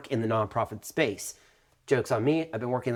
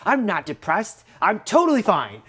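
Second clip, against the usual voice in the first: high, louder indignant insistence; mock-indignant I'm fine; defensive denial; 7 semitones higher, 5 dB louder than usual. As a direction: protest a bit too much.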